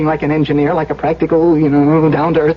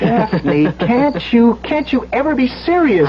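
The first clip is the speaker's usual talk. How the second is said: higher, pleading rise; exasperated plea; 6 semitones higher than usual. Acted. Elwood begging Daphne to take the danger seriously, exasperated. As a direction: beg her to take it seriously.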